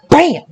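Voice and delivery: high, punchy imitated impact; mock punch sound. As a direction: snap out the hit like a sound effect.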